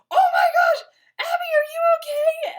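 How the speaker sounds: frantic yell. Retold or imitated. Retelling how he yelled down after the blast, frantic mock shout. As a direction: scream down in a comic panic. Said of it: "yelling down".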